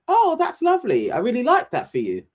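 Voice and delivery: high, sweet, overly nice tone; Millie's sugary voice. Retold or imitated. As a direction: sound sweetly pleased, a bit too much. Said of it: "And she says".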